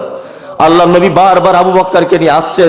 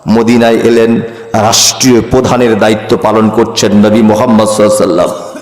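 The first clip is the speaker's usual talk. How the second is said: ordinary talk, nothing marked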